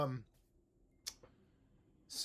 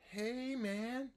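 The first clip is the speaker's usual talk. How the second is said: higher hey man, rising on man; teacher model; 12 semitones higher than usual. Shown as a demonstration. Teacher models rising greeting. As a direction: sing it out. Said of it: "I'll be a small student".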